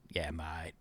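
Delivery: put-on deep lad voice; deep mock bloke. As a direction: play a dopey mate agreeing.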